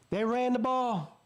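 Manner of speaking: slow, high-pitched triumphant statement; emphatic excitement. Real, not acted. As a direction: say it aloud, stretched out and high. Repeats the point aloud, voiced.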